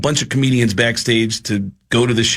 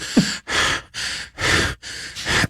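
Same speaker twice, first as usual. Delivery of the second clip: long exasperated unvoiced sigh; heavy breathing; 5 dB quieter than usual. Shown as a demonstration. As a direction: breathe out hard like you're stressed. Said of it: "half of a perfect Mark Wahlberg impression".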